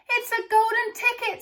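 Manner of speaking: high, loud excited scream. A narrator's reading. Shopkeeper finds golden ticket in his shop, screaming in delight. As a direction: shopkeeper screams with sudden joy. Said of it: "screamed the shopkeeper".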